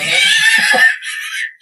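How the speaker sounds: high, loud burst of laughter. Real, not acted. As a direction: crack up laughing. Husband laughs at himself.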